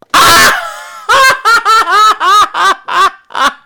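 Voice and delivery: high loud burst of laughter; big real laugh. Real, not acted. Reviewer laughing at his own Ofer joke, loud laugh. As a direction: crack up at the pun.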